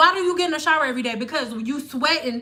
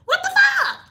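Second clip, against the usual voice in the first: much higher, a sudden disbelieving outburst; incredulous exclaim. Real, not acted. Reacting to the pre-poo idea, high incredulous outburst. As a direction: blurt it like you can't believe it.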